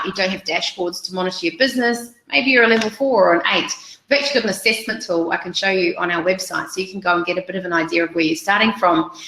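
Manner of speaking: ordinary talk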